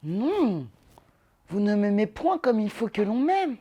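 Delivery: Célimène. Acted. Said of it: "lorsque Célimène dit à Alceste".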